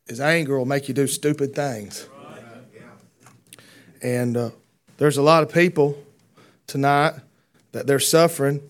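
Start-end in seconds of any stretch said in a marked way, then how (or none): 5.0-8.6 louder preaching, pressing on the point; fiery preaching. Acted. Preacher to the congregation, louder preaching. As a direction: call it out to the church.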